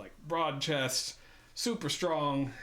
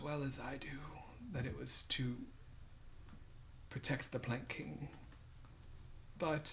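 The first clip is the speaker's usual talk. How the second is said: weak, half-whispered and soft; weak prisoner; 6 dB quieter than usual. Acted. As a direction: speak like a starved prisoner with little breath left. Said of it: "He's very weak".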